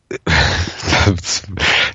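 herzhaftes Lachen; lautes Lachen. Real, not acted. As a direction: losprusten vor Lachen. Lachen mitten in der Antwort. (lacht laut los)

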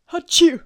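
loud sudden sneeze; big explosive sneeze; sneezing. Real, not acted. Outtakes full of sneezes.

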